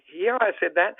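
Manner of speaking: ordinary talk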